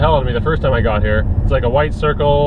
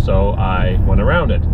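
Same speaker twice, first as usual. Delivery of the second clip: ordinary delivery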